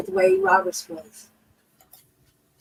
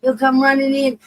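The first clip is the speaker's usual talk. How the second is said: ordinary delivery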